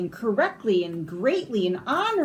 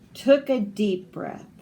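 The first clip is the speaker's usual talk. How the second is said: plain reading voice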